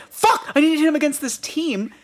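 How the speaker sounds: high exasperated cursing; frustrated high voice. Retold or imitated. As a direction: squeal it in frustration. Fantasy player frustration.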